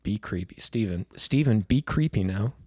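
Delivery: normal talking voice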